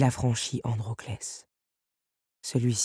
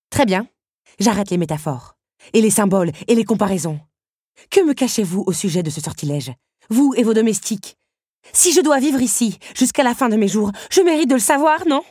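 higher, louder heated demand; angry demand; 8 semitones higher, 8 dB louder than usual. Acted. Belle blocks the beast's way and demands answers, heated. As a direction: fight for the truth, voice climbing.

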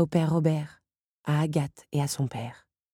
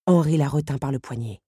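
usual speaking voice

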